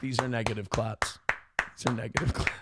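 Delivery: a mild, low, deadpan mutter; negative claps bit. Retold or imitated. Streamer recalls a shared bit with a friend, low deadpan. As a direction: say it dry and low, lightly mocking. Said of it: "We both used to go".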